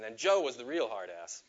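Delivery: normal talking voice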